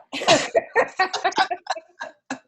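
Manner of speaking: loud, high-pitched laugh; high burst of laughter. Real, not acted. Laughs at repeated dropout.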